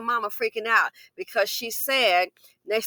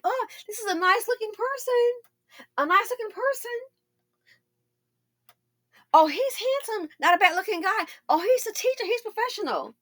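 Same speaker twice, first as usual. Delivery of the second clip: much higher, swooning mock voice; gushing admirer; 12 semitones higher than usual. Mocking a woman charmed by a handsome man, high gushing. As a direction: gush like a smitten woman sizing him up. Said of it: "So sometimes you think".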